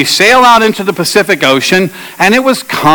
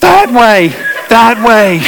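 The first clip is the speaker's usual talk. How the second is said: higher, urgent call; retold yelling; 5 semitones higher, slower than usual. Pastor yelling directions to the friend, urgent higher call. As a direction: point and call the way home. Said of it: "I just keep yelling".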